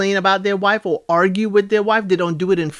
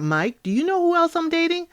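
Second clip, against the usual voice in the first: higher imitated voice, probing; lightly voiced questioning; 10 semitones higher, 4 dB quieter than usual. Megan checking if Mike knows her connection, probing. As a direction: lightly mimic a date fishing for information.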